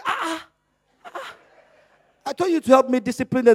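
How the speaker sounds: ordinary delivery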